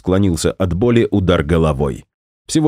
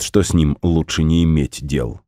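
ordinary delivery

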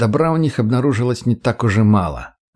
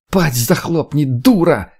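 higher-pitched, furious yell; angry girl's shout; 4 semitones higher, slower than usual. A narrator's reading. Girl from the vestibule yells at woman, angry shout. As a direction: shut her down with a shout. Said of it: "закричала девушка из тамбура".

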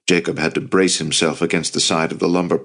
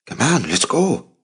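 higher-pitched, pressing call to get moving; mildly insistent, pitch lifted; urging; 7 semitones higher than usual. A narrator's reading. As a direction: urge a companion along. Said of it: "Luke urged".

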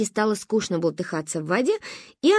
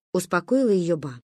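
ordinary delivery

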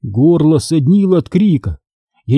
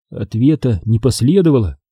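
plain reading voice

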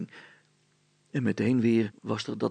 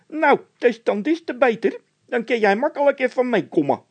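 very high, loud scared voice; a nervous squeaky henchman; squeaky frightened De Wit; 14 semitones higher, 10 dB louder than usual. A narrator's reading. (De Wit, piepend hoog en bang)

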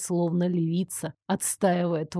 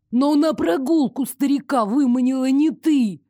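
higher, raised-voice protest; disheartened wail; 5 semitones higher, 4 dB louder than usual. A narrator's reading. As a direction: wail in dismayed objection. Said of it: "обескураженно взвыл Элмер". (обескураженный вопль протеста)

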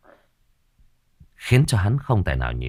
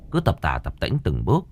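plain reading voice

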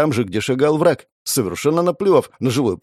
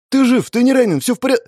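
put-on high, loud, overwrought girl's voice; frantic cry; 12 semitones higher, 4 dB louder, faster than usual. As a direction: blurt it out high, rushing to someone. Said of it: "Она вскрикнула и бросилась ко мне".

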